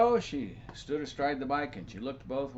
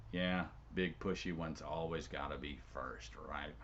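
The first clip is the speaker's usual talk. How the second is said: muttered thought; 8 semitones lower, 7 dB quieter than usual. Acted. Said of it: "And Jenny thought".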